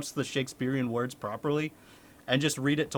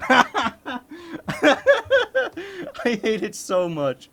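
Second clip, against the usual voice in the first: higher, louder and slow, laughing through it; laughing rant; 11 semitones higher, 6 dB louder, much slower than usual. Real, not acted. Ranting about Shakespeare while laughing, slow and loud. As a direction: drag it out with a laugh.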